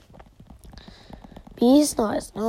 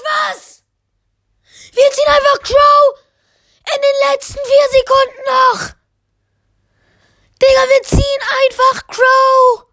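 screaming with joy at a legendary pull; excited screaming; 12 semitones higher, 7 dB louder than usual. Real, not acted. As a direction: yell it out, totally hyped. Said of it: "ich bin grad so heiser geworden".

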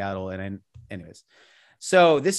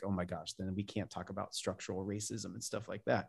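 exasperated aside; 5 semitones lower, 16 dB quieter than usual. Retold or imitated. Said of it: "I'm like".

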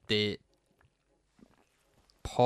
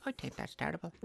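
higher, softer parroting of the friend's words; mimicking his friend; 6 semitones higher, 11 dB quieter, faster than usual. Mocks his co-host by parroting him, higher and softer. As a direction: echo him back in a put-on voice. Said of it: "I'm not just doing it to the nurse".

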